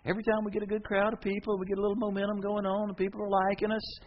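high-pitched acted grumbling; exasperated complaint. Peter complains to Jesus.

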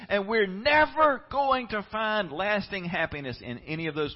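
loud, high sermon climax easing at the end; impassioned preaching. Real, not acted. Preacher saying the world never satisfies, impassioned preaching. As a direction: build to a forceful peak, then ease slightly.

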